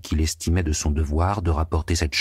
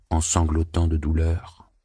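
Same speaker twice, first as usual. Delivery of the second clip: plain reading voice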